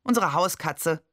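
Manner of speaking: plain reading voice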